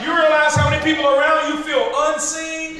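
rising preaching. Acted. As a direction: preach it, climbing toward the cry. Preacher building to climax.

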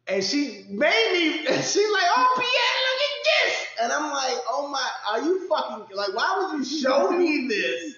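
high, loud disgusted laughing rant; shrieking with appalled laughter. Real, not acted. Ranting about sister's text.

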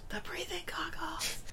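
soft high whisper. Real, not acted. Co-host whispering the echoed joke. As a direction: breathe it conspiratorially. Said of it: "whispered echo".

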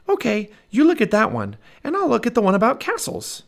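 put-on high excited girl's voice; little girl Annie. Annie calls to Jack, high excited little girl. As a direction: Annie calling out, bright and high.

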